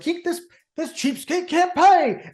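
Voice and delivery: high, slow, mocking cook voice; angry diner owner. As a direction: sneer at the broke customer. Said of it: "he's like".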